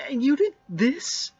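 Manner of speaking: loud, high wounded accusation; hurt angry outburst. Acted. Zack accusing Larry of betrayal, loud and hurt. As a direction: shout it like a betrayed partner. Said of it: "You sound really upset".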